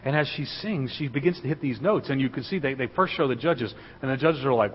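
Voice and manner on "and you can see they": ordinary delivery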